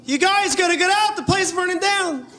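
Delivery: very high flat sing-song warning; urgent words, breezy tone; mismatched cheerful alarm. Acted. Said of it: "a guy who doesn't know how to match his face to what he's saying".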